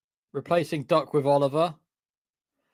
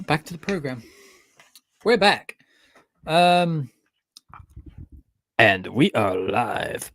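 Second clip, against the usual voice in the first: announcer voice; 4 dB louder, slower than usual. Pretend. Announcing return to stream.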